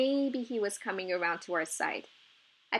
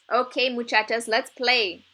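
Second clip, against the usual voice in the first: loud, glad call to the players; shouting; 3 semitones higher, 8 dB louder than usual. A narrator's reading. Coach calling the girls to come and play, loud shout. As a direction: call the girls onto the field. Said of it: "Mister Ramos shouted to the girls".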